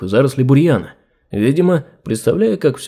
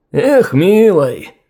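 slow, higher, amused exclamation; cheerful; 5 semitones higher, much slower than usual. A narrator's reading. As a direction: laugh it off fondly. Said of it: "развеселившись".

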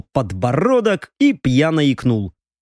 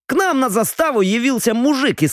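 put-on higher, boastful drunk young noble; tipsy young lord; 8 semitones higher than usual. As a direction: talk like a proud tipsy heir. Viscount starts story.

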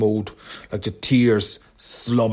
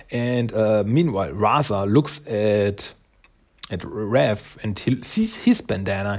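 usual speaking voice

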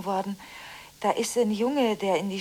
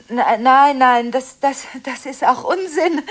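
loud refusal breaking into a nervous laugh; 3 semitones higher, 9 dB louder than usual. Acted. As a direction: refuse loudly, then laugh it off.

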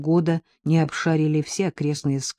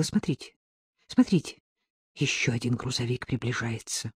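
hushed, understated alarm; agitated warning; 6 semitones lower, 9 dB quieter than usual. A narrator's reading. Agnessa spots another truck approaching, quietly agitated. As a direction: warn them urgently but keep it soft. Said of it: "сильно волнуясь, быстро проговорила".

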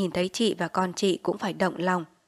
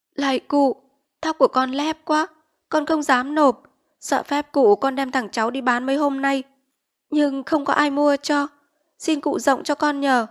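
higher, humble begging voice; pleading poor mother; 7 semitones higher, 6 dB louder than usual. Acted. Poor mother begging the village chief for mercy, higher pleading voice.

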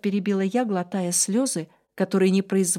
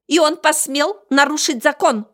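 high, loud angry little beast; shouting creature; 7 semitones higher, 7 dB louder than usual. Acted. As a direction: yell in a squeaky rage. (кричащий зверёк)

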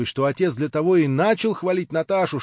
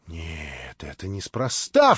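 exclaiming; 11 semitones lower, much slower than usual. A narrator's reading. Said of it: "вскричала Катя".

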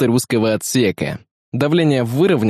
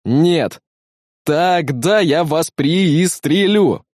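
higher, heavily drawn-out threat; threatening; 5 semitones higher, much slower than usual. Acted. Scofield threatening to shoot, high slow menace. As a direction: threaten slowly, stretching each word. Armed technician threatens the doctor.

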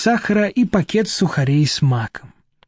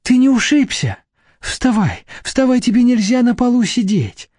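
higher-pitched solicitous wife; anxious fussing; 7 semitones higher than usual. Acted. Wife rushing to her fallen husband, anxiously fussing. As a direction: fuss over him, pitched up.

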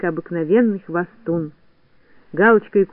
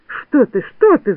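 high, alarmed protest; rising, rattled disclaimer; flustered denial; 6 semitones higher, 4 dB louder, slower than usual. A narrator's reading. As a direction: wave the charge away hastily. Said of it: "замахал Незнайка руками".

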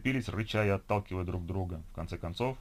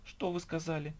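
high, softer, menacing question; quietly outraged, pitched up; affronted challenge; 8 semitones higher, 5 dB quieter than usual. A narrator's reading. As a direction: demand a repeat of an overheard insult. Registrar challenges the overheard insult.

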